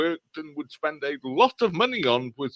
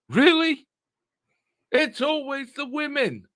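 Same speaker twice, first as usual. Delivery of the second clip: high, loud, slow grow some balls; exasperated rant; 10 semitones higher, 4 dB louder, slower than usual. Real, not acted. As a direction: lash out at the troll. Furious rant at troll.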